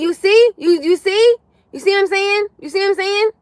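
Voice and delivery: high, loud, rising see; excited insisting. Real, not acted. Insisting to her viewers, high and loud. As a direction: press the point, all worked up.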